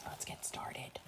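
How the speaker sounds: soft breathy whisper to begin. Real, not acted. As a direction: hush them gently into the story. Whispered before bedtime reading.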